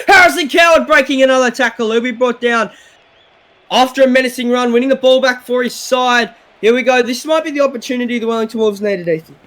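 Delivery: live sports call, steady pace; play-by-play commentary. Acted. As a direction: call the game as it unfolds.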